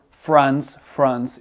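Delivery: "France" with a smooth English R after F; English R mid-word. Shown as a demonstration. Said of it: "pour un R en milieu de mot".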